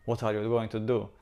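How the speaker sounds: usual speaking voice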